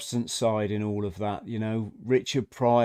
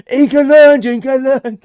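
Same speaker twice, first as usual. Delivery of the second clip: much higher, much louder mock yell; cocky taunt with a laugh in it; chavvy mod; 13 semitones higher, 16 dB louder than usual. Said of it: "He's like".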